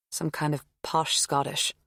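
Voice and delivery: usual speaking voice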